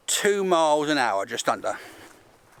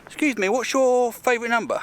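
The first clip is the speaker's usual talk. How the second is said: lightly raised voice, playful questioner; interviewer voice; 7 semitones higher than usual. Pretend. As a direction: ask it like a cheeky reporter. Mock interview with himself.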